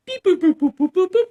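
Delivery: high, loud beep boop chirps; playful robot beeping; loud mock computer beeps. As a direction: chirp it like a kiosk scanner. Imitating facial recognition computer.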